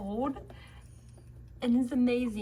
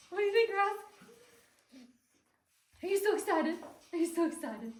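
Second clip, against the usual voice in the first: excited pet talk. Real, not acted. Baby-talks to dog going upstairs.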